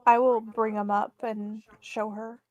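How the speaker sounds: ordinary talk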